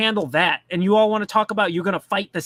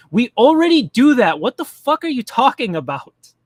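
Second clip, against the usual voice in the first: louder, exasperated snap at the article; exasperated outburst; 4 semitones higher, 8 dB louder than usual. Real, not acted. Ranting at a Fox News comment, louder exasperated outburst. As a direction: let it burst out in disbelief.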